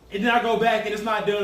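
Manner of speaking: ordinary delivery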